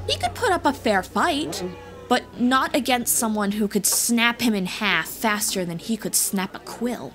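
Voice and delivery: plain reading voice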